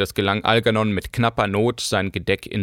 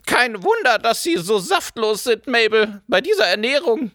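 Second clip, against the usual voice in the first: snapping baroness; 13 semitones higher than usual. A narrator's reading. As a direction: bite at the companion. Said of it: "schnappte die Baronin". (schnappend, bissig)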